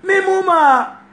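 put-on very high, loud toddler voice; small child. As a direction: call for mama like a tiny child. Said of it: "when you hear a little picnic say".

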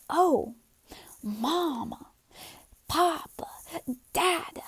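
soft, halting words, nearly whispered; child's first words. Acted. Small child finally figuring out parents' names, softly wondering. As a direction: imitate a child quietly discovering words. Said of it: "finally figure out".

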